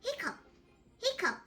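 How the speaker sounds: high little hiccup sounds. Acted. Little Pig apologising between high hiccups. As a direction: pop out two high hiccups. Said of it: "says Little Pig".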